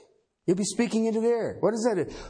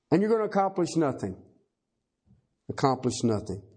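normal talking voice

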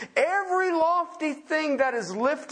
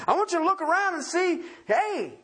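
higher, lightly voiced show-off Creator; a touch of cheeky pride, pitched up; playfully boastful God; 6 semitones higher than usual. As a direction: lightly play God showing off his handiwork. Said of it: "He says".

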